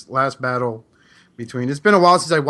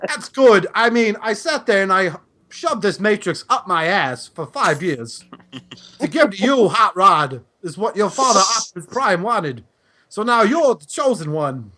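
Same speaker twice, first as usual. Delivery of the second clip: higher, slow mock-solemn war veteran; old soldier Cup; 5 semitones higher than usual. Passing the Matrix to Hot Rod as Cup, hammed up. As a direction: hand over the Matrix with fake gravity. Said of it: "I sound like John Travolta for Mister Cup".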